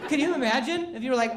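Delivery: ordinary delivery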